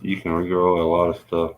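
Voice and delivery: usual speaking voice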